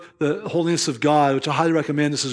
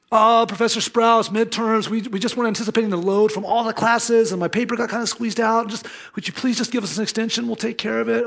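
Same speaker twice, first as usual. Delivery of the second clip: higher, pleading student voice; whiny pleading students; 7 semitones higher than usual. Retold or imitated. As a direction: beg for more time like a nervous freshman. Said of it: "And it was like".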